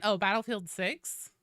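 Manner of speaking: ordinary delivery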